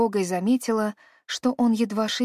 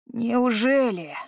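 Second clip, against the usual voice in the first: slow word, voice cracking; voice breaking; 4 semitones higher, much slower than usual. A narrator's reading. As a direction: let the voice crack on it. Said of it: "Его голос сорвался". (срывающийся голос)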